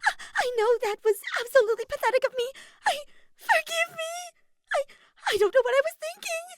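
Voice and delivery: high, loud, frantic apology; stammering, pleading in a panic; panicked, quick succession. A narrator's reading. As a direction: blurt it out in squealing bursts. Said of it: "she said in quick succession".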